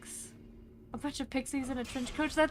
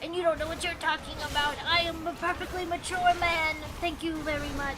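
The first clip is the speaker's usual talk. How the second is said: indignant gremlin insisting he's a mature man, higher and fast at first; huffy little gremlin pretending to be a grown man, pitched up; 4 semitones higher than usual. Shown as a demonstration. DM demoing Hank's voice for viewers, huffy higher gremlin. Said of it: "a very gremlin-y voice".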